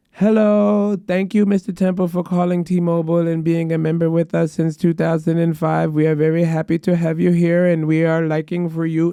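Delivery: put-on Indian-accented call-center agent; imitated Indian accent. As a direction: recite a polite script in an Indian accent. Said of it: "Pardon the accent".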